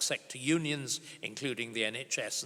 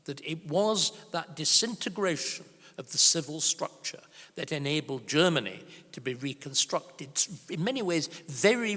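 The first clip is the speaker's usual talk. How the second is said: ordinary delivery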